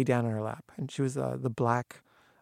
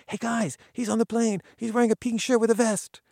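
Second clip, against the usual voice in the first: much higher, louder call across the crowd; 10 semitones higher, 6 dB louder than usual. Retold or imitated. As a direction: shout the news to the pack. Photographer calls out to others.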